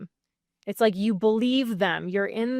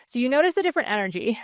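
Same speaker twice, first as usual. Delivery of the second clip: normal talking voice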